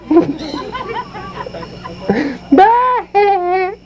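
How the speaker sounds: high squeaky puppet laugh; high dummy cackle; dummy giggle. Acted. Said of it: "here's my ventriloquist act".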